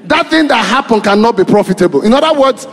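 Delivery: fiery preaching. Acted. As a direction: preach it out to the congregation. Preaching at full force.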